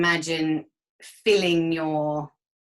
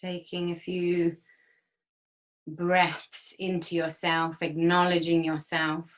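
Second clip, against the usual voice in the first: guided meditation. Acted. Leads a guided breathing exercise.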